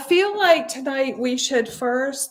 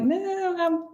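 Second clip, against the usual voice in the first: mock denial; 8 semitones higher than usual. Teacher voices our inner excuses to the class, mock denial. Voicing self-talk that dodges truth.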